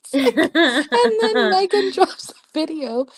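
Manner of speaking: high, loud words through real laughter; laughing. Real, not acted. Telling how Megan drops a video, laughing hard. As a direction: talk while cracking up. Cracking up over the denial.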